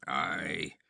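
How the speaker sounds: low, guttural desert tribesman; guttural nomad. Acted. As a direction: grunt it from the throat. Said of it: "guttural accents of a Zuagir".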